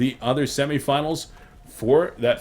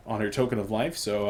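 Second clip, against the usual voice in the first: ordinary talk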